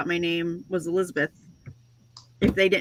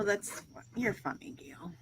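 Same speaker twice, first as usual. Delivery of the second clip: whisper; 14 dB quieter, slower than usual. Real, not acted. Whispered aside to friend.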